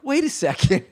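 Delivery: high, sudden wait; his own realization, high; realizing. Retold or imitated. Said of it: "I was like".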